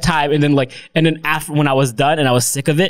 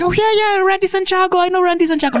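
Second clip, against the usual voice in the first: much higher, gushing; hammed-up starstruck seller; mock excitement. Sales agents pretending they know [name], gushing mock excitement. Salespeople fake recognising a name.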